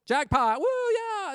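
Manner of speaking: high, loud mock jackpot whoop; hammed-up winner yelling jackpot; jackpot excitement. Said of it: "you know how excited people get".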